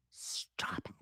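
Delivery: breathless whispered stop it. Retold or imitated. Telling the attorney stop it after his kind text, whispered. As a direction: whisper it, overwhelmed and flattered. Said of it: "And I go".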